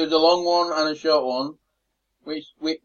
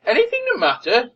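higher-pitched, disbelieving repeat of the question; incredulous echo; 7 semitones higher than usual. Acted. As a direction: throw the question back in disbelief. Acted incredulous reply to Crichton.

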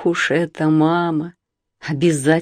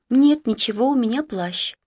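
reserved; 7 semitones higher than usual. Acted. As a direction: answer coolly, holding back. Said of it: "сдержанно ответила Галя".

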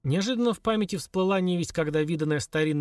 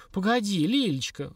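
взмолилась; 7 semitones higher than usual. A narrator's reading. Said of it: "взмолилась хозяйка".

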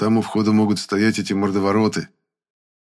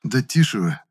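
slightly higher, tired hushing; weary; 3 semitones higher than usual. A narrator's reading. Sleepy boy to quarrelling ghosts, weary. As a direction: hush them with worn-out patience. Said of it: "устало сказал я".

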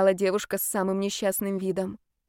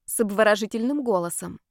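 ordinary delivery, nothing marked

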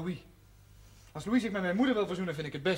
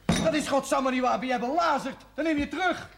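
louder, higher furious retort; fiery defiance of the priest; angry outburst; 10 semitones higher, 6 dB louder than usual. Acted. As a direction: hit back at the insult.

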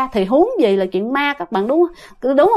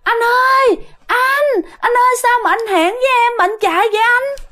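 coaxing she-demon, pitched way up; coaxing ghost woman; 10 semitones higher than usual. Acted. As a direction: call after a fleeing lover in a high voice. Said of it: "nói cái giọng như là cái kiểu".

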